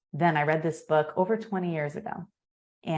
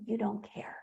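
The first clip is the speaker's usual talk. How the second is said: ordinary talk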